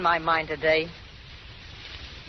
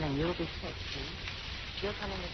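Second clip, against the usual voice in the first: hushed plotting; 13 dB quieter than usual. Acted. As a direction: drop almost to a breath, plotting an escape. Whispering escape plan in cell.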